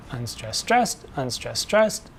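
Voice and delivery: weak "unstressed", strong "stressed", in a steady alternating beat; measured demo with the beat on "stressed"; iambic stress pattern. Shown as a demonstration. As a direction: pulse it in pairs, "stressed" landing harder and lifting up. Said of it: "an unstressed syllable followed by a stressed syllable".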